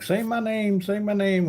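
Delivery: high, needling imitation; imitated pestering demand. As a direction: mimic someone begging to be noticed. Quoting attention-seeking viewer's demand.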